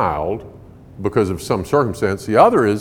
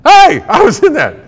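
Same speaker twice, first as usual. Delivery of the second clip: put-on high, loud outraged kid's yelp; indignant shout, pitched way up; protesting kid; 11 dB louder than usual. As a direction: yell it like a child whose game got cut off. Acts out child protesting unplugged game.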